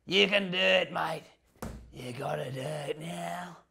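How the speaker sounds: broad Australian pep talk; big Australian man. Acted. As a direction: urge a mate on in broad Aussie, stretching the last words. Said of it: "And he goes".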